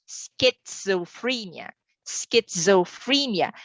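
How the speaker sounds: slow "schizophrenia", each syllable sounded out; every letter sounded. Shown as a demonstration. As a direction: sound out "schizophrenia" in full, nothing silent. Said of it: "here we pronounce the entire".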